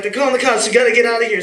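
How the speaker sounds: imitating alarm, high and loud; urgent warning. Friend warning the group that cops are coming, urgent. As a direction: raise the alarm, pitched right up.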